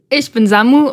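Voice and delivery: ordinary talk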